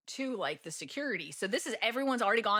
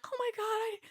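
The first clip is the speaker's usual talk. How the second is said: high-pitched mock-panicked exclamation; retold panic. Traveler reliving a lost purse, high and panicked. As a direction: cry it out in exaggerated alarm.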